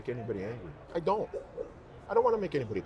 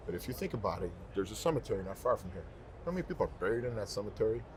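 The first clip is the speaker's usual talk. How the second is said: ordinary delivery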